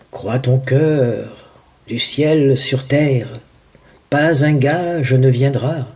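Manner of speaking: slow, stretched-out recitation; solemn verse. Acted. Ivan quoting verse on faith without pledges from heaven, slow recitation. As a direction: linger on every phrase of the verse.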